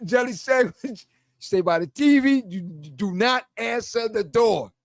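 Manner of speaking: ordinary delivery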